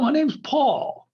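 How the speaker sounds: high, slow posh English Paul. Retold or imitated. Posh English guy saying Paul, high and slow. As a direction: mimic the posh Englishman's vowel. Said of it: "He calls me swimming pool".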